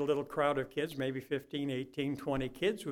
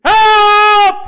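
much higher, much louder scream for help; desperate shout; 22 dB louder than usual. Pretend. Drowning swimmer calling the lifeguard, a desperate shout. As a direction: yell it with everything. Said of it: "What are you gonna do?".

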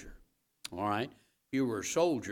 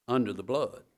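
ordinary delivery

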